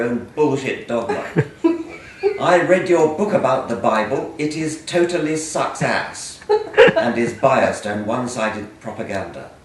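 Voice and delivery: loud, mock-indignant reading of hate mail aloud. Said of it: "read some of your hate mail".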